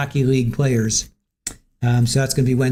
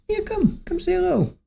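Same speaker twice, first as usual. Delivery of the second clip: very high sing-song coaxing voice, softer; pet talk; 5 dB quieter than usual. Real, not acted. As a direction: coo at the cat to come over. Coaxing the cat.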